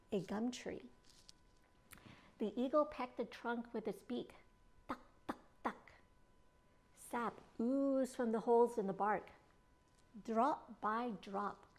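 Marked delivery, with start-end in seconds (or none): 4.9-5.9 soft unvoiced pecking sounds; whispered tuck. A narrator's reading. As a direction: tap out tuck, tuck, tuck in a breath.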